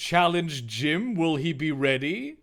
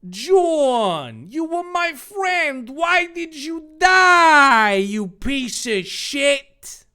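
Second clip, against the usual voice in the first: hammed-up crying; 11 semitones higher, 10 dB louder, much slower than usual. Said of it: "He started to crying".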